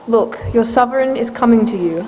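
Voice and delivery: ordinary delivery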